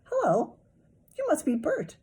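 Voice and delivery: high puppet voice; Sesame Street voice. A narrator's reading. Toddler playing Ernie at sink, high puppet voice. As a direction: chirp it like a Muppet. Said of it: "in his best Sesame Street voice".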